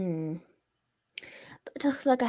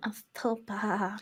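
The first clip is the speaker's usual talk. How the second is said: slow breathy whisper, suggestive; 7 dB quieter, slower than usual. Pretend. That's what she said joke.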